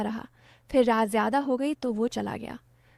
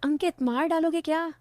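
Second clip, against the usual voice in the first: higher-pitched, lightly acted cry of protest; mild narrated yell; 5 semitones higher than usual. Girl scolding Ankit for braking on purpose, mild yell. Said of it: "मैं चिल्लाती".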